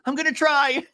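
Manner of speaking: high, mock-strained promise; Shannon. Recapper mimics housewife behind bathroom door, high mock effort. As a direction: squeal it, about to laugh. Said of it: "And then Shannon goes".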